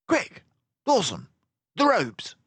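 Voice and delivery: quick urgent call; urgent detective. Acted. Basil urging Dawson to free him. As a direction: hurry your friend.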